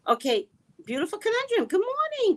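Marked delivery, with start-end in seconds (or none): 1.7-2.4 cheery greeting. Real, not acted.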